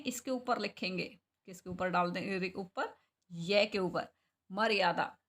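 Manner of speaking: normal talking voice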